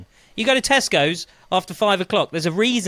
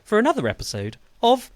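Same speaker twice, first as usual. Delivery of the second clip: slow, lower announcer intro over music; radio show intro; 4 semitones lower, slower than usual. Acted. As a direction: set the scene like a travel documentary narrator.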